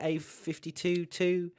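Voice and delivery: "A fifty-two two" with fifty-two joined, then two; first digits paired. Shown as a demonstration. Host tries another car name, grouping "fifty-two" before a lone two. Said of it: "I don't know how they say it".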